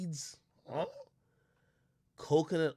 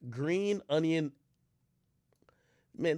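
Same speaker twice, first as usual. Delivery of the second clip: ordinary talk, nothing marked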